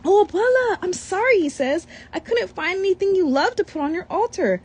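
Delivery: put-on high, earnest little boy; sorry, a bit pleading; lightly voiced young boy; child's voice. Boy apologising to grandmother.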